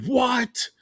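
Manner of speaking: very high mock-shocked cry; retold disbelief, pitch leaping up; incredulous. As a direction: act out being flabbergasted, voice way up. Retold shock at friends' opinion.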